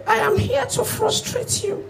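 high, soft, slow demon taunt. Acted. Demon taunting the trekking comedian, high and soft. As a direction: tease him slowly in a thin high demon voice.

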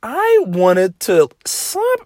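high outraged voice about his mom shopping; shrill exasperation. Real, not acted. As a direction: squeal with disbelief at his mom. Mom went out anyway.

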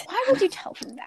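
high, whiny mock outrage. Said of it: "Now we can't".

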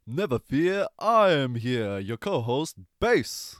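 booming heroic entrance, slow and proud; brash old-fashioned hero bravado. Pretend. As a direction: announce yourself like a cartoon superhero.

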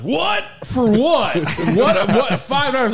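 very high squealing outrage with laughter; mock shocked parents at the door; outraged disbelief. Parents told of a five dollar cover, very high outraged squeal. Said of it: "And they're like".

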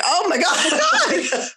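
slow, high, laughing oh my God; laughing disbelief. Retold or imitated. Mom laughing at her son's reindeer logic, high and slow.

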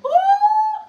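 sudden high falsetto ooh; high whoop. Real, not acted. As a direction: let out a joyful high whoop. Preacher exclaims mid-scripture.

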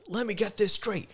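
high, sharp yelled challenge; heated call-out across the counter; retold yell. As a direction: confront him, a heated yell. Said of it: "And I, I yell it".

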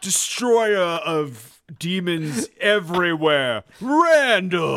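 slow, loud, hammed-up herald; mock epic announcer. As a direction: proclaim a legend with mock grandeur. Mock grand introduction of a hero.

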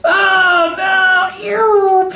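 high, loud fake wail; mock dismay. Catching his own doctrinal slip, loud high mock wail. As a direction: cry out in hammed-up horror.

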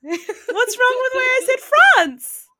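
high, slow words squeezed out through laughter; a mock-offended protest while cracking up; laughing protest. Real, not acted.

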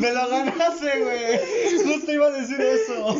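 laughing through the words; high, giggling words. Real, not acted.

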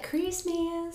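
drawn-out, high Southern Christmas; Southern twang. Shown as a demonstration. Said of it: "You have to do, like".

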